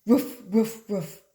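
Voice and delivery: loud barking woof; dog bark. Acted. The dog answering the call, barking.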